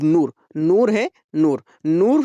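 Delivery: नूर with a long, stretched oo; drawn-out vowel. Shown as a demonstration. Teacher showing the vowel, नूर drawn out on oo. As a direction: hold the oo each time in नूर. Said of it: "उसे खींच कर पढ़ा जाए".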